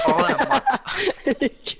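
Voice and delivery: high, slow mock triumph; drawn-out punchline. Telling a friend about his month of classes, slow mock-proud drawl.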